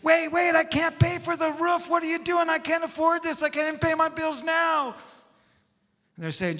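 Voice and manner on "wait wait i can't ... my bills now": very high-pitched frantic yelling; hammed-up screamed protest; screaming. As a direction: shriek in comic panic at the friends. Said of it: "That guy on the mat screaming".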